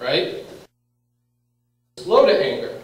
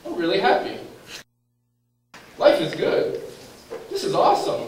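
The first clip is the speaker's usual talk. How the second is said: upbeat, higher-pitched example of delight; happy self-talk; 6 semitones higher than usual. Acted. Teacher voicing someone noticing they are happy, cheerfully. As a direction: lift the pitch in mock happiness. Acted example of positive emotion.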